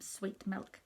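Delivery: usual speaking voice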